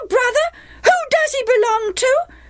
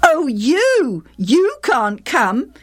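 high, taunting dismissal; scornful boys; 10 semitones higher than usual. A narrator's reading. Half-brothers leaving Jephthah behind, scornful and taunting. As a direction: shut him out with mocking scorn.

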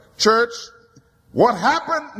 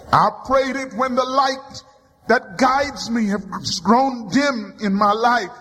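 ordinary talk, nothing marked

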